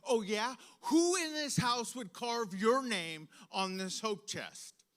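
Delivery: hammed-up scolding, each word driven home; angry mom. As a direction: imitate a furious mother cornering her child. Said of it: "she looked at me dead in the eyes".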